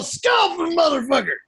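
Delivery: mock war-cry holler; put-on savage sideline shout; hammed-up yell. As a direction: yell it out across the field. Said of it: "and yell".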